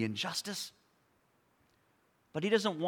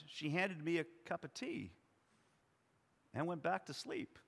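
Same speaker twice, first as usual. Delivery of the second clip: normal talking voice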